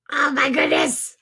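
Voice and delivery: usual speaking voice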